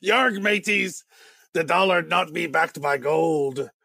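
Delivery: slow, hammed-up pirate growl. Answering the pirate chest joke, hammy pirate voice. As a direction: play a cartoon pirate, slowly. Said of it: "Did you just empty your pirate chest?".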